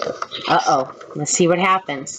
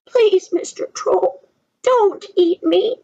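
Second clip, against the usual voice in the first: much higher, pleading little goat; small goat pleading; 6 semitones higher, slower than usual. A narrator's reading. Little goat begging the troll, high pleading voice. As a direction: beg the troll in a tiny voice. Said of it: "he said".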